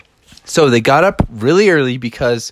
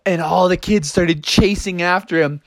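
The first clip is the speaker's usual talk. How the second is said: ordinary delivery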